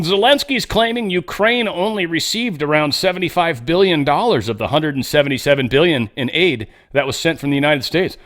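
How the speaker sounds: ordinary delivery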